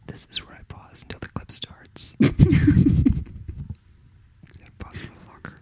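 whispered aside; soft whisper to the listener. Real, not acted. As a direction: whisper while the clip loads. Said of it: "whispered while waiting".

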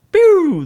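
a quick high loud imitated siren zap; mock siren pew. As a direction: blurt the cop lights like a sound effect. Cop lights flash on.

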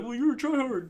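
high, loud whining retort; mock sore loser. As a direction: whine it loudly like a beaten gamer. Imitates sore opponent's retort.

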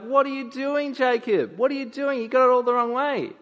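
high, loud alarmed protest; Joseph protesting. Retold or imitated. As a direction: cry out in dismay as the upset son. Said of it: "he's like".

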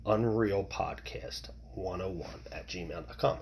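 a confiding whispered repeat of the email. Shown as a demonstration. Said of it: "repeats email address, now whispered".

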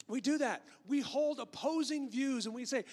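usual speaking voice

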